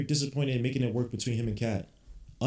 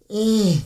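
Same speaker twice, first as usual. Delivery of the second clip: disgusted groan; 9 semitones higher, 8 dB louder than usual. Real, not acted. Disgusted with the team.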